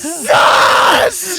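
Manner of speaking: high shouted sus; wild unhinged ranting. Retold or imitated. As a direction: yell it like a madman. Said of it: "sounded like a fucking madman".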